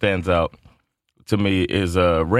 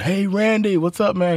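put-on higher, eager kid calling out; eager fan greeting; 9 semitones higher than usual. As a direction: call out to your hero. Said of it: "he's like".

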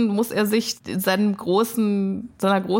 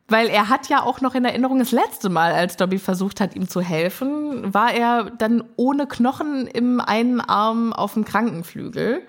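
ordinary talk, nothing marked

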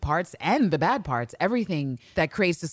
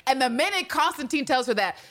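ordinary delivery

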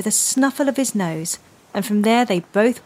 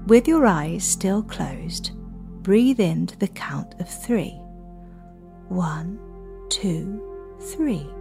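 soft spa whisper; 6 dB quieter than usual. Acted. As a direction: guide the children calmly to breathe. Leads children's breathing meditation.

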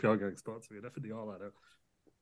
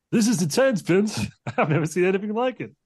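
excited commentator; 8 semitones higher, 4 dB louder than usual. Acted. S0 voices commentator again.

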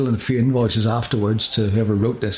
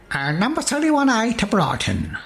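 mock grandeur; 9 semitones higher than usual. Mock grand quest announcement.

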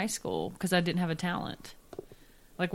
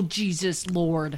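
normal talking voice

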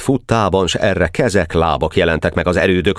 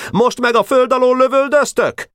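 very high, outraged cry; shouted protest. A narrator's reading. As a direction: shout the question at the enemy, high. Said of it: "kiáltott". (magas hangú, felháborodott kiáltás)

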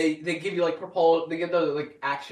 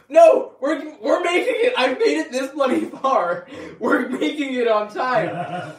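much higher, louder, laughing; frantic reassurance; 7 semitones higher, 7 dB louder, slower than usual. Retold or imitated. Reassuring his crying mom in the speeding car, loud and laughing. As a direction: shout over the panic.

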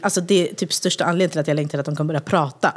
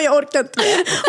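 weary mom; 8 semitones higher, slower than usual. Retold or imitated. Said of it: "Hon bara".

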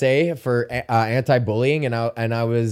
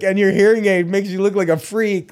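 put-on higher taunting old man jeer; mock old bully; 6 semitones higher than usual. Second old bully mocking the hearing aid, jeering. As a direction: sneer it like a mean old man. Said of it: "one was like".

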